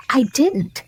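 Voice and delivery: high, quick interruption; hurried denial. A narrator's reading. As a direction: cut in fast, denying it. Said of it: "interrupted in a great hurry".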